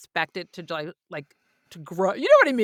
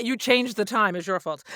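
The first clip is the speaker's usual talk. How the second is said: normal talking voice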